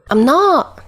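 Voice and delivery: put-on high, childish whine; whining. A narrator's reading. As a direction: whine it like a sulky kid. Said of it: "Dazai whines, a little louder than usual".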